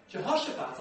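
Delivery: normal talking voice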